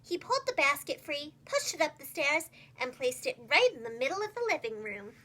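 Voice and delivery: usual speaking voice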